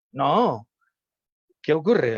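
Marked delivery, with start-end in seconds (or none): 0.1-0.7 put-on louder, insistent child no; child's loud no. Shown as a demonstration. Child rejecting the wrong name again, loud no. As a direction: snap back like an annoyed kid.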